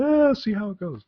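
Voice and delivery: imitating his own casual try; retold self quote.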